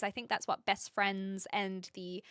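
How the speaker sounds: ordinary talk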